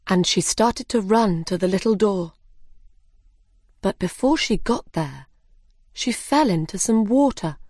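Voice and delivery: ordinary delivery, nothing marked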